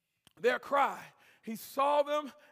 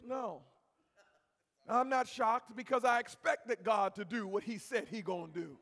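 usual speaking voice

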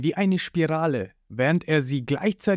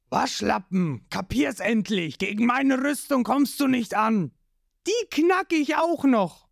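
sneering villain; 8 semitones higher than usual. Acted. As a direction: taunt the beaten hero, building up. Creek taunting Ruffy.

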